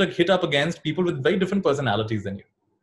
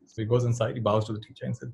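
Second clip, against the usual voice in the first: usual speaking voice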